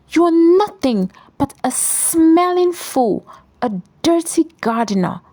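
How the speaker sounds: high, sharp and fast with contempt; high scornful shout. A narrator's reading. Michelle mocks her husband, a sharp high scornful shout. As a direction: spit the insult at him. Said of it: "and shouted".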